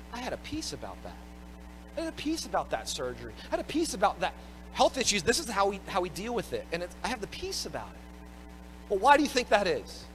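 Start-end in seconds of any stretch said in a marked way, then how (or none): 0.1-8.9 softer, hushed voice of relief; quietly content, a hint of peace; calm, quiet retelling. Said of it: "you'll hear people say this".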